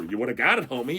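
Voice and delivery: ordinary delivery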